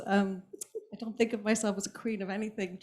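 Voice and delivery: ordinary delivery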